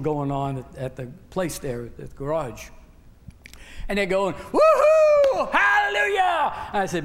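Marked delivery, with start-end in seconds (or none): 4.5-6.5 high, loud celebratory whoop; cheering shout. Retold or imitated. Garage workers shouting for joy over the raise.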